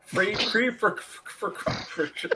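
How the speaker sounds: words dissolving into breathless giggles; trying to say it but cracking up; laughing through it. Real, not acted. Telling friends about the friction cream, laughing.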